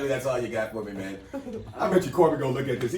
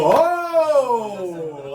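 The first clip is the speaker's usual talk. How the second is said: big recognising exclamation, higher and louder; the crowd hearing a truly famous name, excited oh; 7 semitones higher, 6 dB louder than usual. Shown as a demonstration. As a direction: gasp it like you just spotted a star. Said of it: "every last one of us go".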